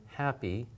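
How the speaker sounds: "happy" with a relaxed throat and light h; quiet, easy English h on "happy". Shown as a demonstration. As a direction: say "happy" softly, throat loose. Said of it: "Instead of being relaxed".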